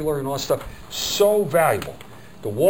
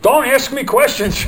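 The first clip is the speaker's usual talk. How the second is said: much higher, louder and slow; mock bossy; 12 semitones higher, 9 dB louder, slower than usual. Trainer joking to the class not to interrupt, much higher and louder. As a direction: ham up a prima donna order. Joking about his flow.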